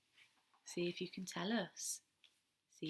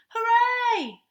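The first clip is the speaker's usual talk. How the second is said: loud, high cheer; excited cheer; 15 dB louder than usual. Acted. Chicks cheer at story time, joyful hooray. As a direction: cheer with delight like happy chicks. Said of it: "they cried".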